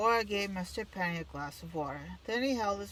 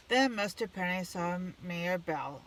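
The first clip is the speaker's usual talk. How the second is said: usual speaking voice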